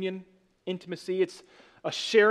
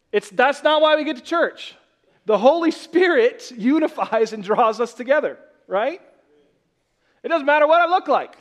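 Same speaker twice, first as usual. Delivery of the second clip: preaching emphasis; 6 semitones higher, 6 dB louder than usual. Real, not acted. Preacher driving the point home.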